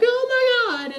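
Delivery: very high, loud shriek; full-out fanboy scream; screaming in excitement. Retold or imitated. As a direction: scream it like a stunned fan. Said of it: "screaming at the top of my lungs".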